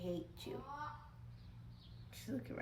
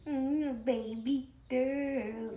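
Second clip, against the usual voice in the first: slow coo; 5 semitones higher, 7 dB louder, much slower than usual. Real, not acted. Cooing at dog.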